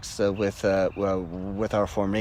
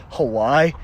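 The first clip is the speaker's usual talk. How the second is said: louder, rising, incredulous question; surprised outburst; 3 semitones higher, 6 dB louder than usual. Retold or imitated. Young Marine breaking rank before his first sergeant, surprised outburst. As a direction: blurt HAWAII as a disbelieving question. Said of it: "I just broke rank and I said".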